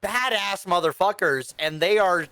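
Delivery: ordinary talk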